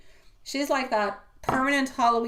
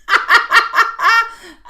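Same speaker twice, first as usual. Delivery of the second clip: short, high, loud burst of laughter; real high-pitched laugh; 14 semitones higher, 14 dB louder than usual. Real, not acted. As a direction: crack up suddenly and loudly. Laughing about her weekend date.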